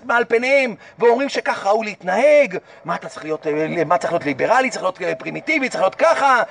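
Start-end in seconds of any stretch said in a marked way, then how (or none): 2.8-6.4 mocking imitation. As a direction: mimic the modern world with scorn. Mocking what modern society demands.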